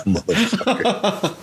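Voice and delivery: high, slow laughing curse. Retold or imitated. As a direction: drag it out through a laugh. Laughing through retold quote.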